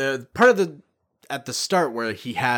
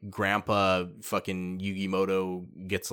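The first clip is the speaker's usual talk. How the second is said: normal talking voice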